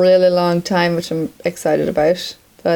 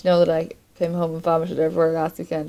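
ordinary delivery

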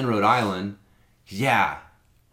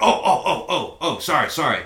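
higher, startled string of ohs; flustered apology; 5 semitones higher than usual. Retold or imitated. Drunk passenger realising it is a cop, flustered apology. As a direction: backpedal fast, suddenly realising.

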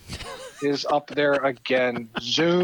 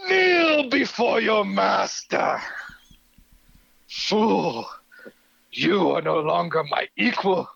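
Skeletor booming demon lord. Acted. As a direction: start dark and hushed, end bellowing like a god. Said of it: "I wanna give you the floor here to do the monologue".

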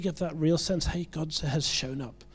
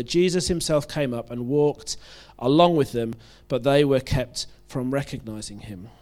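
louder, measured Bible reading to the congregation; scripture reading; 5 dB louder than usual. Acted. Preacher reading Luke aloud to the congregation, in a reading voice. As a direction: read the passage out to the church.

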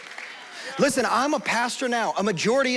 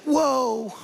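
a higher, airy whoa with a laugh in it; Mama Heidi's whoa; 6 semitones higher than usual. Retold or imitated. Preacher mimics Heidi touched by the Spirit, higher laughing whoa. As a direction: let the Spirit catch you mid-word, lightly mimicked. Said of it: "every now and then she'll be like".

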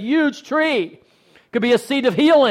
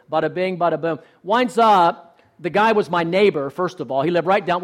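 normal talking voice

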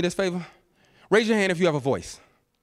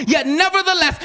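ordinary talk, nothing marked

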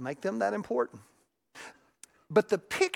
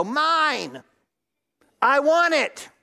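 put-on high, louder bratty toddler voice; possessive toddler; 6 semitones higher, 6 dB louder, much slower than usual. Pastor voicing a toddler snatching a toy, high and louder. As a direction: grab it back like a spoiled kid.

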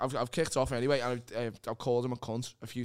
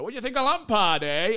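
higher, louder nosy boss voice; mocking the boss; 6 semitones higher, 7 dB louder than usual. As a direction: ape the nosy Manchester boss asking about footy. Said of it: "that's what he sounds like".

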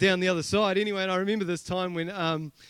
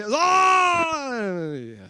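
high, loud yelp of shock; mock yelp as the toy hits dad's head; pained cry; 7 semitones higher, 5 dB louder than usual. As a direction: cry out as the hard toy cracks down. Kermit toy cracks dad's head.

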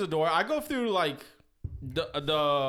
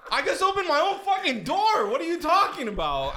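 high, loud, laughing protest; incredulous shout, cracking into laughs; indignant retort; 12 semitones higher, 5 dB louder than usual. Retold or imitated. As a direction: fire back in disbelief, laughing. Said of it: "And I was like".

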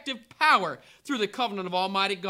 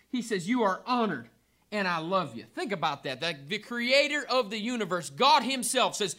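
usual speaking voice

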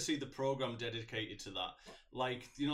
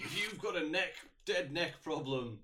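slow, raised announcer pitch; drawn-out, higher voice-over parody; mock TV intro; 4 semitones higher than usual. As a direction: announce it like an action-show intro. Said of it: "what the en-, intro would be".

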